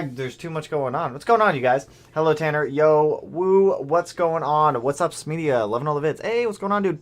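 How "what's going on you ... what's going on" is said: normal talking voice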